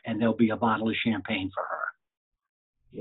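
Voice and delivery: slow, hushed whisper sharing a treat. Retold or imitated. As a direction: lean in and whisper the surprise like a secret. Bobby's promise retold, whispered.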